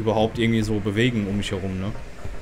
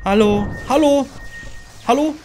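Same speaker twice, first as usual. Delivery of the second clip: high, loud, nervous hello; alarmed calling out; 13 semitones higher, 4 dB louder than usual. Real, not acted. Player to whoever is knocking, alarmed calling out. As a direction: call out louder and higher in alarm.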